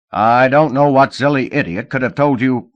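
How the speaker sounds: angry rant. Acted. Manager angry.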